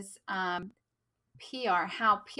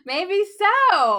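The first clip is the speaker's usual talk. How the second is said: laughing; 11 semitones higher, 12 dB louder than usual. Real, not acted. Laughing reply to joke.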